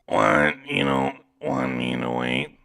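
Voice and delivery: slow, eerie ghoulish female voice; drawn-out spooky woman's voice. Acted. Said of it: "that ghoulish voice for, uh, Elora".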